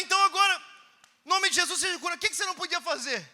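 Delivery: high and loud declaration; loud commanding prayer. Retold or imitated. Preacher to healed dealer, loud and high. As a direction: command it, then ask boldly. Commanding healing, then questioning.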